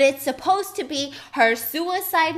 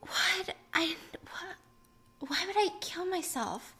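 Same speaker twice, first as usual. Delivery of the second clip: soft, high, bewildered; imitating a drowsy daze; confused and groggy; 6 semitones higher, 9 dB quieter, slower than usual. Waking daughter answering the officer, soft and bewildered.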